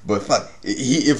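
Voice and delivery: normal talking voice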